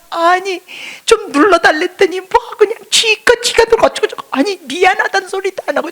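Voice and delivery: high, whiny complaining to God; pouring out complaints. Retold or imitated. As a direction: vent every grievance, half laughing. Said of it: "막 쏟아냈어요".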